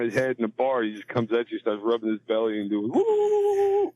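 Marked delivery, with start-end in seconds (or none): 2.9-3.9 whoop. Retold or imitated. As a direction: let out a high whoop. Imitating the big guy's whoop.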